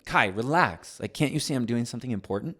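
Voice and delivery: irritated. Retold or imitated. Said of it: "I'm telling".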